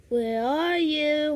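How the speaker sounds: slow, stretched-out monster call; play-acted menacing hunt; creepy monster. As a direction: draw it out like a stalking monster. Monster calls to hidden victim.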